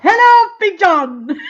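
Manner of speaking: loud, high, slow "Hello, pigeon" in a put-on voice; silly pigeon guy voice. Imitating the viral video guy greeting his pigeon, loud and high. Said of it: "I live in my house with my pigeon".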